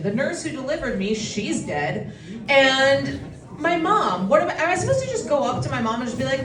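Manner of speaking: usual speaking voice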